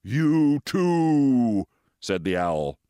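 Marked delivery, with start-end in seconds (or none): 0.0-1.7 louder, solemn owl call; owl's pronouncement. Acted. Owl spotting moles in the dark, solemn and loud. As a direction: boom it like a wise owl.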